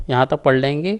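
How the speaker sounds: usual speaking voice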